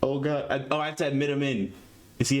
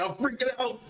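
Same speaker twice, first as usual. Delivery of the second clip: freaking out; 10 semitones higher than usual. Real, not acted.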